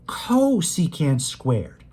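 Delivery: "cosecant" with a long, punched CO; stress on CO. Shown as a demonstration. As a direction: hit and hold the "co" of "cosecant". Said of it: "I was just emphasizing the co".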